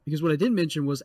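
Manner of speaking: ordinary talk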